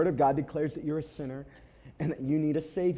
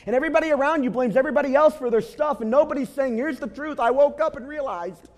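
high, loud preaching with every line driven hard; impassioned sermon peak, loud and high; full-force preaching; 12 semitones higher, 8 dB louder than usual. Acted. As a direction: preach it out to the whole room at full volume.

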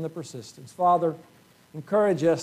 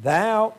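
THOU pushed out, a touch higher and louder; emphatic thou; 6 semitones higher, 4 dB louder than usual. Shown as a demonstration. Preacher stressing Paul's word to Timothy, emphatic THOU. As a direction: lean hard on THOU. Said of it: "thou is emphatic".

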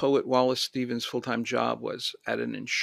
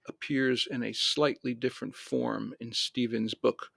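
ordinary delivery

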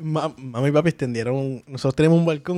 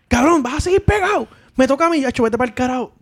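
mock bossy brother; 9 semitones higher than usual. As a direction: imitate the brother demanding his turn. Brother demands the video game.

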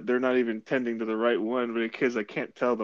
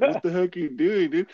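shocked teammate; 7 semitones higher, 3 dB louder than usual. Retold or imitated. Quoted teammate reaction in story.

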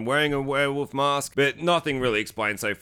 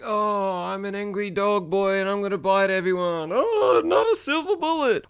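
put-on high, whiny angry dog boy; hammed-up monster wail, climbing at the end; mock werewolf; 6 semitones higher than usual. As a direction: make fun of a werewolf in a high voice.

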